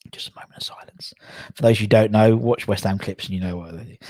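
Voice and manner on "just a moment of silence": whispered, voice all breath; hushed whisper. Pretend. Mock memorial, whispering a moment of silence. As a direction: hush it like a solemn tribute.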